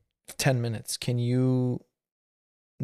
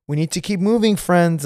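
higher, imitated condescending park worker; mock patronizing; 5 semitones higher, 4 dB louder than usual. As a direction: chivvy people along with fake friendliness. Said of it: "is so patronizing".

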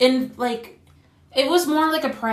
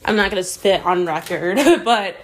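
normal talking voice

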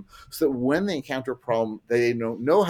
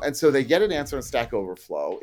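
usual speaking voice

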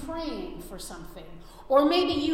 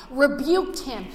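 ordinary talk

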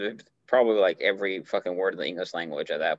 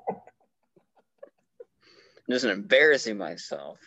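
ordinary talk, nothing marked

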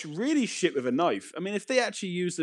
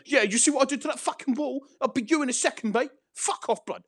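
high-pitched chav bravado; mock chav; 11 semitones higher than usual. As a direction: imitate a chav threatening a zombie. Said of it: "And then he's like".

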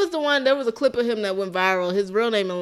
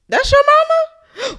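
much higher, louder and slow, imitating the agent; shocked question; 9 dB louder, slower than usual. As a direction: drag it out in disbelief. Real estate agent's shocked question.

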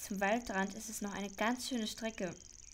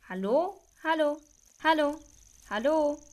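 loud, hopeful calls across the clearing; calling out; 6 semitones higher, 8 dB louder than usual. Acted. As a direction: call out into the woods, louder each time. Calling out for hidden person X. (laut rufend in den Wald hinein)

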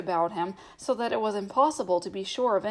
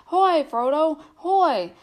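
high, loud call from afar; hailing a friend through the mist; distant cry; 7 semitones higher, 7 dB louder, slower than usual. A narrator's reading. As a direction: cry out across the hills. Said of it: "he thought he heard a cry".

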